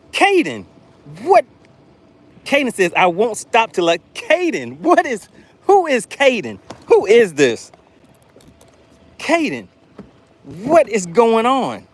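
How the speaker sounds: high, incredulous questions; can't believe what he's seeing; shocked disbelief. Real, not acted. Another surprise super chat arrives.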